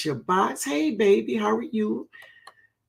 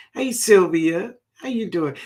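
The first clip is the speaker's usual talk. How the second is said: normal talking voice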